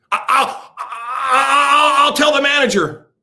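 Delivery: mock a panicked shopper wailing; hysterical crying shout. Voter threatening to get manager.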